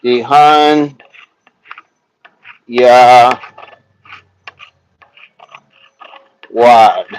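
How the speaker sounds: Dehan, Ya, Wad as separate syllables with long pauses; sounding it out. Shown as a demonstration. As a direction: break "Dehan Ya Wad" into slow, isolated pieces. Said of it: "Sound it out".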